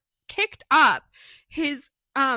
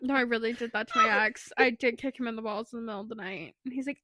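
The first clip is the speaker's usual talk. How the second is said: ordinary talk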